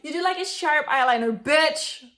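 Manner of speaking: loud, rising disbelief; shocked outburst. Real, not acted. Reacting to her old eyebrows with loud disbelief. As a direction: blurt it out, stunned and loud.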